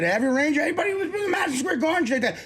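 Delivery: high, rushed outburst; worked-up fast tirade; heated rant. Real, not acted. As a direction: fire it off in exasperation, pitch climbing. Ranting about the team owner.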